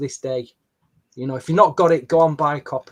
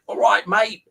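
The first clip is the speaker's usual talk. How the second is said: higher-pitched Aussie greeting, lightly imitated; a touch of an Australian accent; 5 semitones higher, 3 dB louder than usual. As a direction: imitate an Australian's friendly reply. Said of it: "I get this Australian accent".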